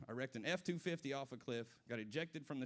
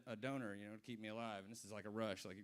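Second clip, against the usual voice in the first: normal talking voice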